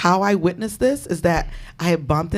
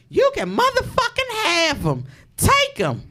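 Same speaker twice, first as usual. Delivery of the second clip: high, mocking voice of a furious girlfriend; mock angry girlfriend. Mock angry girlfriend cursing her out on the phone. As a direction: imitate her cursing you out.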